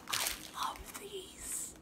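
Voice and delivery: hushed, slow, secretive whisper. Real, not acted. Finding treats he plans to keep, secretive whisper. Finds favourite snack.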